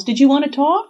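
loud, high, rising question; calling after someone. A narrator's reading. Sarah catches Nora on the stairs, calling. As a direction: call it out, lifting at the end. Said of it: "she calls".

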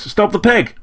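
high-pitched urgent warning; alarmed cry. A narrator's reading. Nis warns of the loose pig, alarmed cry. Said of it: "cried Nis".

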